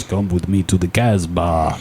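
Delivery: low, soft seductive monster voice; seductive Sasquatch. Pretend. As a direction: purr it low like a beast. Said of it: "and goes".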